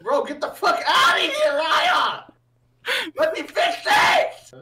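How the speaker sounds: high, loud laughing yell; laughing shout. Real, not acted. Laughing while shouting at friend.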